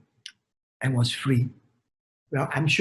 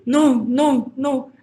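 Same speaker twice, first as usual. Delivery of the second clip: despairing cry; 7 semitones higher, much slower than usual. Acted. Thin frog sees friend drown.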